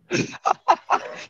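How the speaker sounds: high-pitched burst of laughter. Real, not acted. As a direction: laugh out at the rival's boast. Laughs at opponent's boast.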